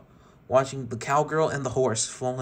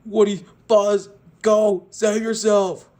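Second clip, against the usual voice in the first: much higher, much louder desperate yell; anxious scream; 9 semitones higher, 9 dB louder, slower than usual. Acted. Rex screaming at Woody and Buzz to run. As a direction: scream at them to flee. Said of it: "screaming anxiously".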